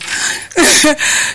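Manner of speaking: breathy voiceless chuckle; laugh. Real, not acted. Host teasing listeners about the hint, chuckling breathily. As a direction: laugh it out mostly on air.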